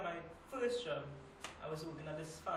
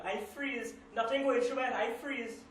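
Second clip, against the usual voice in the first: raised, higher-pitched outburst; acted alarm; 6 semitones higher, 7 dB louder than usual. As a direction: relive the shock louder and higher. Reliving having a gun at throat.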